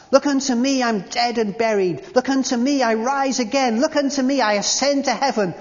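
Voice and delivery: fervent preacher's chant; preaching at full force. Retold or imitated. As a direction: call out the refrain like a revival preacher. Imitated preacher's repeated refrain.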